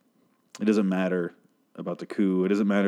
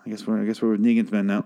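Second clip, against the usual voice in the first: ordinary talk, nothing marked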